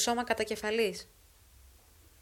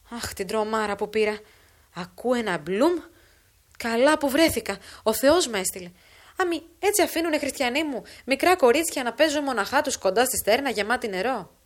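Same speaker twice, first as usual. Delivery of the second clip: put-on somewhat louder, busy storytelling old woman; old woman's lively false account; 2 semitones higher, 7 dB louder than usual. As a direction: spin the lie briskly as a shaken neighbour.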